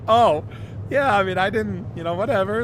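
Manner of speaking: high, loud, laughing it off; amused shrug. Retold or imitated. Telling friends the hat didn't matter, high and amused. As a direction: brush it off while cracking up.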